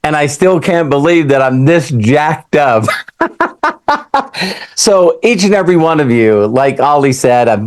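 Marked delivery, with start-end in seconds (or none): 2.9-4.7 high, rhythmic burst of laughter. Real, not acted. Speaker joking to the audience about himself, high laugh. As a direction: laugh in quick, high pulses at your own joke.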